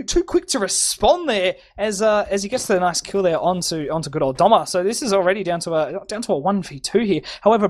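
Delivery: ordinary delivery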